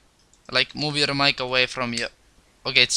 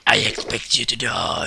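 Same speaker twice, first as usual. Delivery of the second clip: villain voice; 5 semitones lower than usual. Pretend. Villain movie quote.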